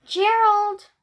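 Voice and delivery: calling out. Acted. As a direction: call out the name brightly as a story character. Calls out to Gerald in the story.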